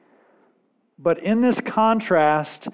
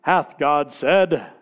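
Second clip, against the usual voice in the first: slow, archaic serpent's question; King James voice; 4 dB louder, slower than usual. Acted. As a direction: draw it out like old scripture, sly. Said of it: "in his best King James voice".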